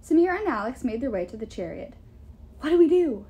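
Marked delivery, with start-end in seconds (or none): 2.6-3.2 urgent, raised-pitch question; urgent hiss. A narrator's reading. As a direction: ask it in a tense, louder undertone. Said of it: "Alex hissed".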